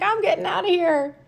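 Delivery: high, fast little critter voice; raccoon voice. Pretend. Voicing the fleeing raccoon for the child, high and quick. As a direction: squeak out the escape. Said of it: "He's like".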